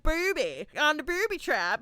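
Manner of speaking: put-on a light accent on "Booby" said "pə˞bi"; little accent. Imitating Data's little accent for the booby trap gag. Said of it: "he said it with, like, a little accent".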